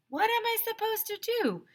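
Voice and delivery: high, whiny protest; exasperated. A narrator's reading. A narrator whining to the listener that he can't help it. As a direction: throw up your hands at the listener.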